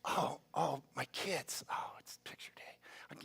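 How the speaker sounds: soft, slowing whisper; whispered waking dread. Pretend. Parent waking up and remembering kids' picture day, whispered dismay. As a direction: whisper it as a groggy realization. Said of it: "then you're like".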